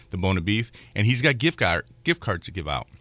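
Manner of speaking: normal talking voice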